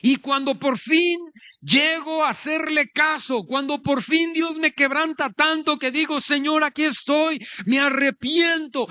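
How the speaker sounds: high, fervent preacher's cry; impassioned preaching. Acted. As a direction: preach it at full pitch, pleading. Preacher builds to cry of repentance.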